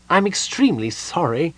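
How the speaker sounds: high-pitched, apologetic kender voice; guilty apology. A narrator's reading. Kender sorry about the gnome's map, guilty. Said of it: "feeling guilty".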